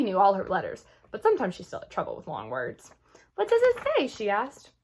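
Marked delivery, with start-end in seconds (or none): 3.3-4.1 put-on high, bright child's question; young girl asking, pitched high; eager little girl. A narrator's reading. Little sister asks eagerly.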